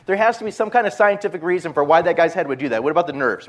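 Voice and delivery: mildly frantic theorizing; scared cops searching for an explanation; panicky reasoning. Retold or imitated. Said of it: "these guys start panicking".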